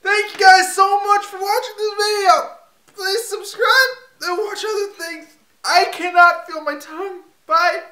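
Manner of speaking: loud, high, crying sign-off; crying shout. Real, not acted. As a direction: wail through the pain. Pain peaks during sign-off.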